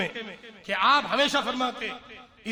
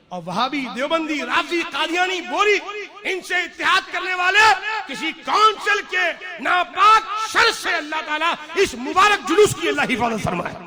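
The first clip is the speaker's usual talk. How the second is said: rising into loud, higher denunciation; fiery preaching; 5 semitones higher, 8 dB louder than usual. Acted. Preacher warning the crowd, louder and fiery. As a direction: thunder it at the congregation from the pulpit. Preacher denounces sects, full force.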